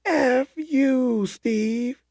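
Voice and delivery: high mock shout across the water; hammed-up far-off yell; yelled insult. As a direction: fling the insult across the sea. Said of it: "And then he says".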